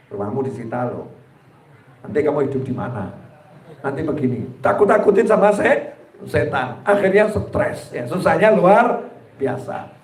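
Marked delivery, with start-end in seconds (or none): 0.1-4.5 low, soft menacing whisper of Satan; whispering devil. Retold or imitated. Satan frightening a man about his debts, low sly whisper. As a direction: sink low and soft, tempting and scaring.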